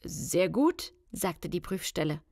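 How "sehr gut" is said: hint of a pompous official; lightly put-on male inspector; disguised caller giving approval; deep man's voice. A narrator's reading. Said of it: "sagte eine tiefe Männerstimme".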